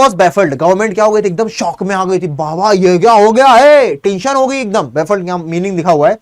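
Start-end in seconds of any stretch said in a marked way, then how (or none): 2.3-3.9 shocked official. Acted. Government voiced in shock.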